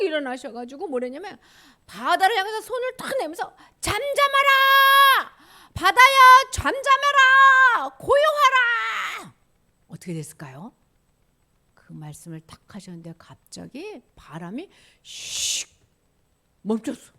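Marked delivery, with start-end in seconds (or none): 3.8-9.3 loud, high, drawn-out command; commanding shout. Acted. Jesus commanding the sea to be still, long booming shout. 15.0-15.7 wind dying away. Acted. Preacher mimics the wind stopping, short hushed whoosh.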